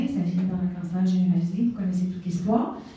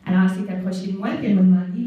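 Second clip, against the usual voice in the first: normal talking voice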